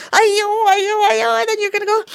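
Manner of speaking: high, loud mock wail; mock panic. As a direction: wail of a stuck exam taker, hammed up. Acting exam panic again.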